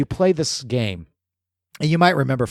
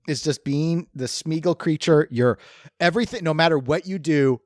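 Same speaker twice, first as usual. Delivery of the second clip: normal talking voice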